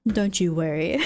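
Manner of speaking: ordinary delivery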